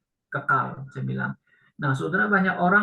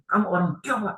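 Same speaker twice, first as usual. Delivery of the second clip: higher, hurried mock berating; angry scolding; 4 semitones higher, faster than usual. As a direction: imitate an elder's indignant telling-off. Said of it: "dia marah maki-maki ya". (meniru orang marah memaki)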